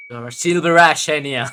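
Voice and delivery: loud, playful proclamation; exclaimed dialogue. Acted. Streamer voicing a line to Enya, loud exclamation. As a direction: call it out with sudden force. Acted line, then breaks into laughter.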